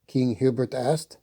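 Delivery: ordinary delivery, nothing marked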